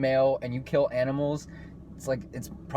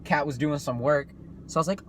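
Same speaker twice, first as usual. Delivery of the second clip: usual speaking voice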